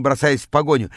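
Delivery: ordinary delivery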